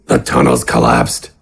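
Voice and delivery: a grim giant finishing the sentence; Gafgarn's voice. Acted. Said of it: "Gafgarn finished".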